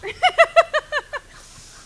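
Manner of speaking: high, loud burst of laughter; real loud laugh. Real, not acted. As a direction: burst out laughing at your own remark. Host laughs at her own aside.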